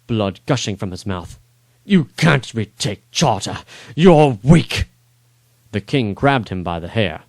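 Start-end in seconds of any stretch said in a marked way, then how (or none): 1.8-4.9 dying sneer. Acted. Dying lord sneers at king.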